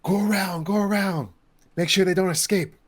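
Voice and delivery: high urgent cultist voice; muttering cultist. Acted. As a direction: call out to the others in a hurry. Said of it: "like someone saying".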